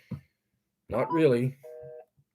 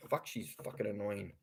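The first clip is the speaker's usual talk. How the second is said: much lower and softer, grumbled under the breath; muttered aside; 11 semitones lower, 17 dB quieter, faster than usual. Real, not acted. Vlogger grumbles to himself about the woman who complained: muttered aside. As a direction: mutter it to yourself, annoyed.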